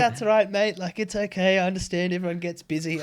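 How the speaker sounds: high, loud sugary mock-politeness; butter up the tailor in a mock-sweet voice; overly nice customer. Customer mocking himself being too nice to the tailor, high mock-sweet.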